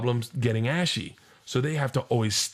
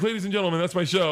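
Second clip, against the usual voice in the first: higher, shouted showman sign-off through a laugh; announcer sign-off; 8 semitones higher, faster than usual. Retold or imitated. Comic ending his set over a rioting crowd, showman shout. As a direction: wrap the show like a ringmaster. Comic ends show amid chaos.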